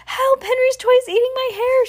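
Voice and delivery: very high, squealed cry for help; high-pitched storybook alarm; panicked shout. A narrator's reading. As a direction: shriek it like a girl in trouble. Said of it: "she shouted".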